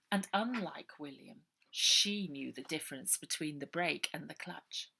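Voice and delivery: usual speaking voice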